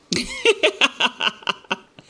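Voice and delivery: high loud burst of laughter. Real, not acted. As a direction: cackle out loud. Laughs at own joke.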